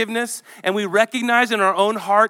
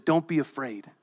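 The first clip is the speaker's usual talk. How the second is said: quiet reassuring angel; tender reassurance; 5 dB quieter than usual. Acted. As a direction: comfort them like a frightened child. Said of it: "And so he says".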